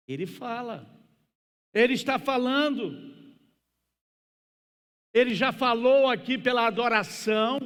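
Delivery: high, shouted pulpit proclamation; fervent preacher at a roaring climax; impassioned preaching. Real, not acted. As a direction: cry it out to the whole congregation at full force. Preacher climaxes at full force.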